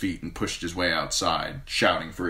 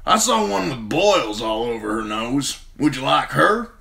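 higher, louder rough sellsword; 7 semitones higher, 6 dB louder than usual. A narrator's reading. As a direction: play the cocky sellsword.

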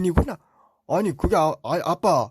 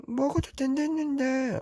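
put-on high little-kid voice tattling to dad; little brother voice; 11 semitones higher than usual. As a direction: whine it like a small child telling on his brother. Younger Teletubby complains to dad.

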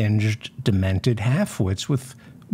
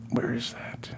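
whisper; 9 dB quieter than usual. Real, not acted. Searching for the clip.

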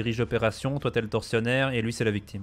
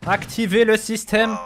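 higher, louder mock German accent; hammed-up German accent commanding the doctor; 8 semitones higher, 3 dB louder than usual. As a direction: bark the order like a German officer. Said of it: "je prends un accent allemand".

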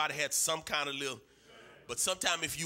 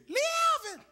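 high falsetto holler, loud; mother calling out; 7 dB louder than usual. Retold or imitated. Mother calling her son home from playing, calling out. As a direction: holler the child's name up high.

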